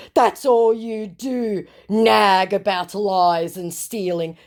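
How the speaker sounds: a scammer moaning about nagging, drawn out; mock whiny scammer. Reading scammer's whiny reply aloud.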